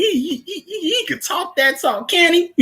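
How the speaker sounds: laughing hard while admiring him. Real, not acted. Laughing while praising a character.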